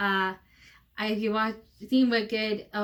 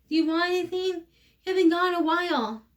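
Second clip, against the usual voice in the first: excited fussing; 6 semitones higher than usual. Acted. Mother fussing over returned daughter.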